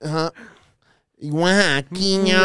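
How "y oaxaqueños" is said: slow stretched street speaker call; tinny loudspeaker loop; crackly looped vendor recording. Retold or imitated. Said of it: "Es la bocina".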